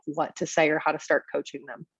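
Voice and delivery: ordinary talk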